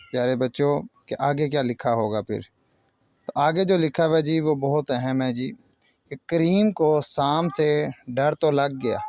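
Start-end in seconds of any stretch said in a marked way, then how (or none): none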